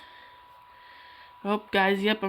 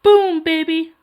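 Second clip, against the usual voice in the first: put-on loud, higher "Boom, baby" shout; cartoon catchphrase; South Park quote; 7 semitones higher, 10 dB louder, slower than usual. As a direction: belt the catchphrase. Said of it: "I say".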